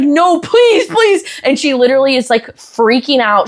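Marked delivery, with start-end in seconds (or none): 0.0-1.2 high-pitched mock begging; pleading child. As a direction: beg like a kid in big trouble, pitched way up. Child begging mom not to punish.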